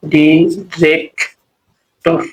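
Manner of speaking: ডিরেক্টর drawn out slowly; slow pronunciation. Shown as a demonstration. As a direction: stretch ডিরেক্টর syllable by syllable. (ধীরে টেনে ডিরেক্টর)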